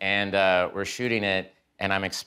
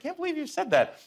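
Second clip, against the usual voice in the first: annoyed mutter. Retold or imitated. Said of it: "I'm really annoyed".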